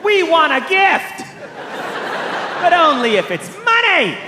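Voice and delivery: high, slow mock wail, drawn out; demanding college. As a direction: play a greedy college begging at the top of the voice. College's letter acted as begging demand.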